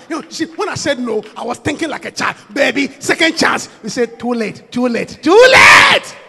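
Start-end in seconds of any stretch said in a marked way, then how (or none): 4.2-6.2 rising loud, slow too late; shouted refusal. Acted. Preacher refusing the beggar, shouted and slow. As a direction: hammer each too late harder.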